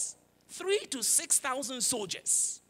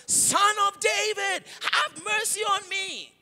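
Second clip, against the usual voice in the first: high, drawn-out cry at raised volume; shouting for mercy; 8 semitones higher, 4 dB louder, slower than usual. Retold or imitated. Blind beggar calls out to Jesus as he passes, shouting. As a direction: belt it out slowly as the blind beggar. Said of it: "at the top of his lungs".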